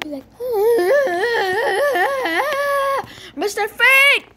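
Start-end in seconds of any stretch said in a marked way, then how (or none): 0.3-3.3 high, loud, long wavering wail; mock wailing. Player protesting to Mister Freak, high wavering wail. Wordless wail before calling friend. 3.4-4.3 high, loud cry of the name; protesting yell. Real, not acted. Player telling off a friend, high loud yell.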